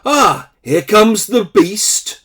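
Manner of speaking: high, loud, drawn-out teasing announcement; mock announcement. Daughter heralding her brother at breakfast, teasing announcement. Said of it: "coming down the stairs and announced".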